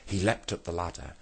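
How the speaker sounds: ordinary delivery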